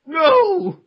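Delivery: high, loud cry of agony; dying scream. Acted. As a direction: scream as if struck down.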